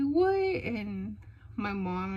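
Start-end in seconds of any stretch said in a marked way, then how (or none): 0.0-0.3 high, loud astonished cry; freaking out. Retold or imitated. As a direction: imitate a thrilled, disbelieving niece. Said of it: "she's all freaking out".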